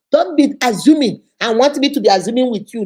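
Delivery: a loud no-nonsense telling-off; firm scolding. Acted.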